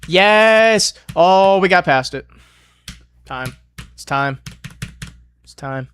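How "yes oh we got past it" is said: high, loud burst of relief; excited yell. Real, not acted. Gamer clears a tough section, excited yell. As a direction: cheer as you clear it. Gets past hard section.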